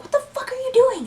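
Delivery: very high, fast mock outrage; imitated incredulous guy. Mimicking Jake finding her in the hallway, high incredulous voice. Jake's alarmed reaction, acted.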